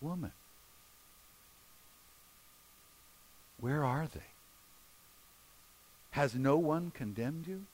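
soft, gentle question with long pauses; quiet, tender questioning; gentle asking. Acted. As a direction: ask her gently, leaving space between phrases. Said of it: "he asks her gently".

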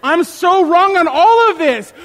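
high, loud, drawn-out mock surrender; over-the-top imagined wife giving in; wife conceding, imagined. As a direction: gush the admission, exaggerated and slow. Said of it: "she'll be like".

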